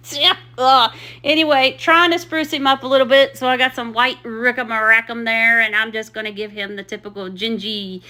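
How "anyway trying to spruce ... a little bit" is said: ordinary delivery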